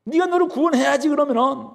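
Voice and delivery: high, needling taunt; mocking taunt. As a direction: goad him to save himself. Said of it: "이렇게 지금 희롱하고 있는 것이죠".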